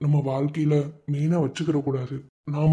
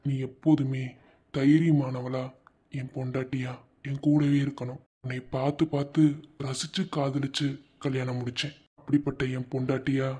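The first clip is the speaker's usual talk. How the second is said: much softer, low thinking aloud; quiet inner monologue; 4 semitones lower, 6 dB quieter than usual. Acted. Husband resolving to find out why his wife refuses a child, in a soft inner voice. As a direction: muse to yourself, barely voiced.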